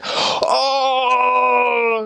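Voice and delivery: high, delighted cry of surprise; excited exclaim. Retold or imitated. Passengers to the missionaries, delighted high "oh". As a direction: gasp with delight.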